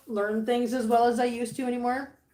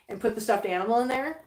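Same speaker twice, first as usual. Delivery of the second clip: usual speaking voice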